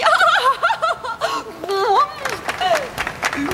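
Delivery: high, gleeful witch cackle; drawn-out mock-sweet cackle; wicked queen cackle. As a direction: cackle like a queen who just won. Stepmother queen laughs after wedding.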